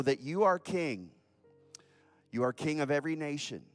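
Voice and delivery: ordinary talk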